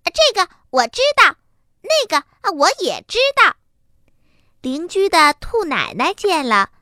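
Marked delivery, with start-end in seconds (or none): 0.0-3.6 high, quick boastful chirp; a know-it-all bunny blurting out; show-off little rabbit. A narrator's reading. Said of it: "它都在边上嚷嚷着". (高声抢着嚷嚷的小兔子)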